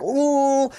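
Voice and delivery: high, long comic ooh; mock pained ooh. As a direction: imitate slapstick pain with a high hoot. Imitates all three in pain.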